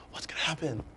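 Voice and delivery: mock suspense whisper; hushed, breathless guess at the plot. Mocking mystery-film whispering.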